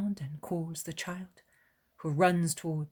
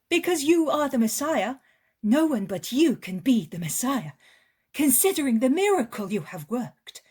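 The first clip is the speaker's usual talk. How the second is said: much higher, louder woman's voice; an eager hostess welcoming a guest; eager village woman; 6 semitones higher, 9 dB louder than usual. Acted.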